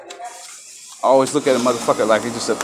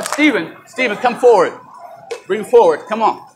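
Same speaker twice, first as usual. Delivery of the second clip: calling out; 6 semitones higher than usual. Real, not acted. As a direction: summon him over sharply. Calling the security guard over.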